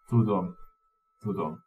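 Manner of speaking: soft, sighing, fading to almost nothing. A narrator's reading. Dumbledore comforting McGonagall with a soft sigh. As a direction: let the words go out on a sigh. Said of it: "sóhajtott".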